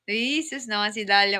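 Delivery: ordinary talk, nothing marked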